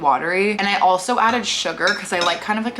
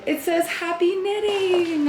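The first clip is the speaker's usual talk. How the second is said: cute high read; 10 semitones higher than usual. Real, not acted. Reading the cute box label.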